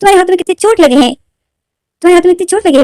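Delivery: high, loud alarmed mother; mother shocked at the wounds; worried mother. Retold or imitated. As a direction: gasp at the child's burnt hands. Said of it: "माँ बोलती".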